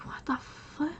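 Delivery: breathy stunned whisper, half under the breath; a hushed retold what the; shocked whisper. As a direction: mutter it in disbelief. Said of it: "I'm like".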